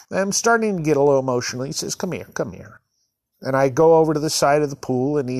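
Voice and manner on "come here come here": soft, low, hushed; gentle beckoning. Retold or imitated. Lifeguard calling an upset kid over, softly. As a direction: quietly coax him over.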